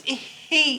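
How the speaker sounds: plain reading voice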